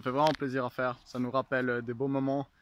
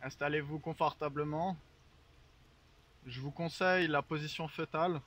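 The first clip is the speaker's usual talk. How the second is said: guided meditation; 5 dB quieter than usual. Acted. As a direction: lull them into calm. Leads a mock guided meditation.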